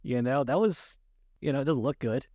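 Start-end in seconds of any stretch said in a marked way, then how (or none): none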